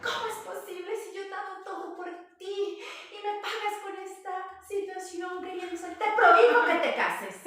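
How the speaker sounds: high and loud, a guilt-tripping scolding; indignant mother. Retold or imitated. Mother kangaroo forbidding her son's marriage, furious. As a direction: lay on the guilt, then forbid it at full volume. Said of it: "mother forbids the marriage".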